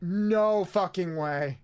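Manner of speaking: each word slow, No hit hardest; slow emphatic no way. Real, not acted. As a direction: drag out No fucking way, heavy on No. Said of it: "repeat slowed hard".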